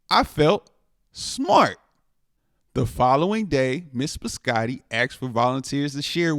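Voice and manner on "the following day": plain reading voice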